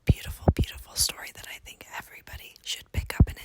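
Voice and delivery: soft unvoiced ASMR whisper. Shown as a demonstration. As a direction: whisper close to the mic, gently. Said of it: "was an A S M R whisper".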